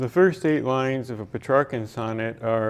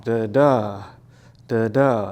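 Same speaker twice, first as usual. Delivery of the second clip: louder "da da" beats, weak then strong; iamb rhythm demo; 5 dB louder, slower than usual. Shown as a demonstration. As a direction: punch out "da da" as an iamb. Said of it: "an iamb is".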